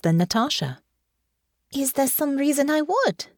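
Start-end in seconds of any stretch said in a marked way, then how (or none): 1.7-3.3 much higher puzzled question. Acted. Honor, confused, questions Natasha, puzzled. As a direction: ask it high and baffled.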